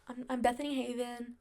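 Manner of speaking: shy, half-swallowed name; timid. Shown as a demonstration. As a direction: say your name unsure of yourself. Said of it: "more bold".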